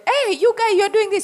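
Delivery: very high, loud throat voice; high-pitched shouting. Shown as a demonstration. Trainer demoing a high-pitched throat voice to students. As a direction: squeal it up high like a shrill radio shouter. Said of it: "the difference in the intonation".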